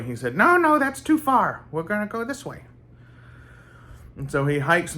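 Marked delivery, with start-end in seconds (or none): 0.4-2.6 very high-pitched character voice; high eccentric character voice; Bobby Justin. Retold or imitated. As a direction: ask in a high, put-on voice. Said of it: "do a Bobby Justin impression".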